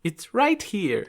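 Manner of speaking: high, slow put-on character voice; polite lady character. Miss Buffin shows the bag of coin, high slow voice.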